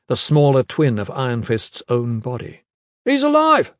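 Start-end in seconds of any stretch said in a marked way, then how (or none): 3.1-3.7 high, loud cry from afar; shouting. Acted. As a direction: call it out across the shore. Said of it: "someone shouted".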